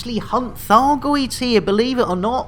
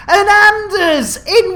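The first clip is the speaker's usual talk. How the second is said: excited call-out; 11 semitones higher, 4 dB louder, slower than usual. Real, not acted. Excited greeting of a new viewer.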